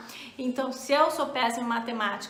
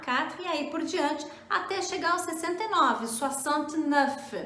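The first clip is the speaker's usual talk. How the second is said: normal talking voice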